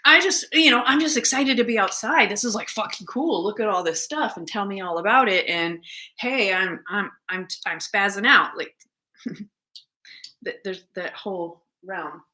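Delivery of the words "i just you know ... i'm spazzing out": high, giddy puppy chatter; a dog bubbling over on its walk, high and excited. Retold or imitated. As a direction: gush like a dog thrilled to be outside. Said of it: "they're saying".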